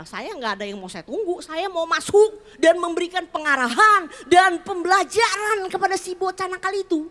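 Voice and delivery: loud, high, worked-up objection; indignant inner protest. Retold or imitated. Mother insisting she must discipline her naughty child, indignant and loud. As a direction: protest loudly, punching the big words. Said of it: "Itu pikiran saya".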